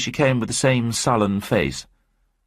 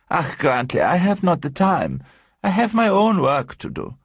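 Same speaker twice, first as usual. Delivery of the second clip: higher, rising protest from a German wife; a German wife objecting to her husband, higher and rising; 9 semitones higher than usual. Acted. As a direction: push back at your husband, voice climbing. Mrs Kapor protests to her husband.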